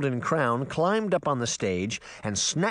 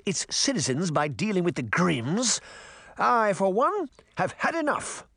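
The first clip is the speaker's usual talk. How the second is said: high, imperious rant; ranting queen; 6 semitones higher than usual. Acted. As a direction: rouse the crowd for a change. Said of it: "The Queen continued to rant".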